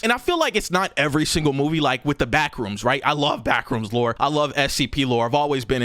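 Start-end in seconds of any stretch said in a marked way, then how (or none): none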